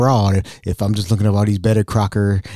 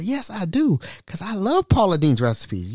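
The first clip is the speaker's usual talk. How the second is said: proud squeak; 8 semitones higher than usual. Retold or imitated. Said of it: "I said".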